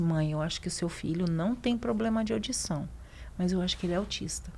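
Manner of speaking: voz suave de médico, recontada; dê a notícia baixinho, com delicadeza; cuidadoso e carinhoso. Retold or imitated. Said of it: "com muito cuidado, muito carinho". (tom baixo e cuidadoso, imitando o médico)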